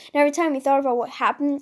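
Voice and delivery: ordinary delivery, nothing marked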